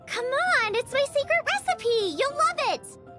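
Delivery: high, excited sales pitch; eager coaxing. Acted. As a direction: coax them eagerly to have a taste. Cook coaxes reluctant taster.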